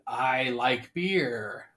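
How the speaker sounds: slow, soft robotic monotone. Retold or imitated. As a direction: say it like a dim robot. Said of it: "And he goes".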